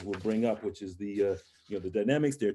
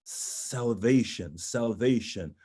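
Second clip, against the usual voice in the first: "salvation" slowly intoned, first sound held long; meditative; much slower than usual. Shown as a demonstration. Coach recalls meditating in the corner, meditative "salvation". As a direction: dwell on "salvation" like a mantra. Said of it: "meditating in a, a yoga-like mode".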